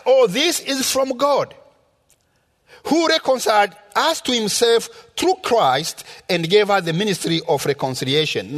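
normal talking voice

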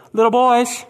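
high, loud mocking call; a stranger mocking the fishermen; derogatory taunt. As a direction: taunt them from the shore. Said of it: "completely derogatory".

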